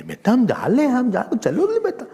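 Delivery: put-on high doting baby talk with a laugh; parent's baby talk. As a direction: coo back at the toddler in lisping baby talk. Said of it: "mother, father also talk".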